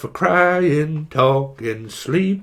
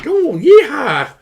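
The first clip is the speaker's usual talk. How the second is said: cowboy voice; 5 semitones higher, 5 dB louder than usual. Retold or imitated. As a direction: whoop it like a rodeo rider. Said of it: "It's like".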